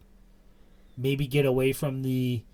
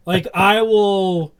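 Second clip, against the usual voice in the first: excited; 6 semitones higher, 7 dB louder, slower than usual. Real, not acted. Dreaming of a wrestler's surprise debut.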